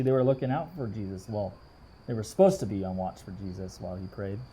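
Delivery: usual speaking voice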